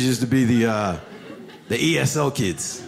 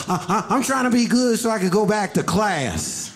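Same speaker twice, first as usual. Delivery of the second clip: put-on kid voice; 6 semitones higher than usual. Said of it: "He's like".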